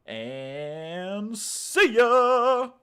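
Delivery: stretched loud see ya; drawn-out goodbye. Real, not acted. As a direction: draw out the farewell. Drawn-out stream sign-off.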